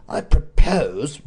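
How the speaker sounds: slow bitter emphasis. A narrator's reading. The Duke to Knowles, slow bitter opening. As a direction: spit it out slowly with bitterness. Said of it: "spoke with a bitterness".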